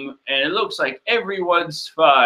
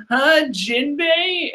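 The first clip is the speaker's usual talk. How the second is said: high slow Chopper; 11 semitones higher, much slower than usual. Acted.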